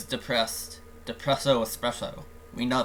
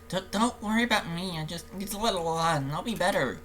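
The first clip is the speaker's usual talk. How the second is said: put-on higher-pitched, reluctant girl's reply; troubled Sayori; 4 semitones higher than usual. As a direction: play a depressed friend putting someone off. Voices Sayori in the game.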